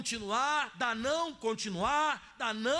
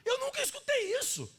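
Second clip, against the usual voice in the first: much higher, astonished voice for Jesus; amazed Jesus; 5 semitones higher than usual. Retold or imitated. Preacher voicing Jesus amazed by the woman's reply, much higher. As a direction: let Jesus sound stunned by what he hears. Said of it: "como se ele dissesse em outras palavras".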